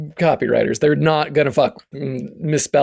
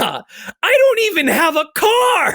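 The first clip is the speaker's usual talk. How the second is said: incredulous high voice. Retold or imitated. Retelling reply to grandma.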